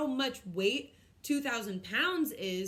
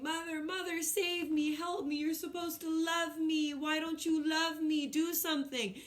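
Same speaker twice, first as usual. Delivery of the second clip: high, pleading mock cry for help; mock screaming for mother; 6 semitones higher than usual. Kid pretending to scream for mother during tickling. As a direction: wail it like a kid begging mom. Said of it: "screaming for my mother".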